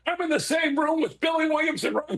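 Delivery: high-pitched, starstruck rush of disbelief; awed fan excitement. Retold or imitated. Retells own awed thrill.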